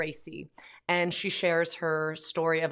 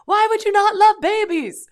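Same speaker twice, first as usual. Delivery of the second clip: put-on much higher, much louder squealing kid voice; astonished toddler; 14 dB louder than usual. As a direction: cry it out like a child who can't believe it. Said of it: "And he's like".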